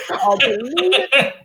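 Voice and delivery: real laughter through the words; laughing. Real, not acted. Laughing at his own phone gaffe.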